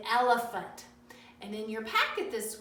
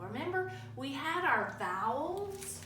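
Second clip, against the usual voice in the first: ordinary talk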